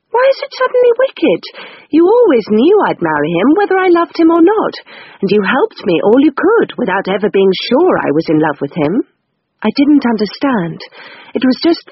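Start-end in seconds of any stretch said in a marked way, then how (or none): 0.1-9.1 much higher, indignant; Rose's voice. Acted. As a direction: protest the sudden accusation. Rose indignant.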